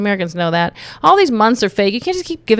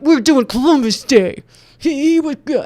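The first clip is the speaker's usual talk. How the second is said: mock right-winger; 4 semitones higher, 4 dB louder than usual. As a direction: caricature a stubborn Columbus Day defender. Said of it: "if you're on the right".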